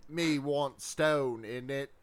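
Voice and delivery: lightly voiced caveman with a British accent, slow. Joking to a friend about how cavemen talked, British caveman voice. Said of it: "cavemen would talk like".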